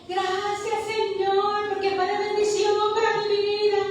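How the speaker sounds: high, sustained cry of thanks to God; acted thankful prayer. Acted grateful prayer after obeying.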